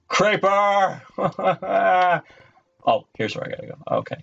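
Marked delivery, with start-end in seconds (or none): 0.1-2.2 high startled shout then laugh; startled yell. Real, not acted. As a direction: yelp at the creeper, then laugh. Creeper appears.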